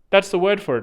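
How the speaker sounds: ordinary delivery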